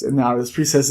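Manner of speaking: plain reading voice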